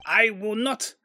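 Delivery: put-on high, indignant French accent; bad French accent. Prosecutor refusing to calm down, bad French accent. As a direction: argue back in a hammed-up French accent. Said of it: "A, a really bad French accent".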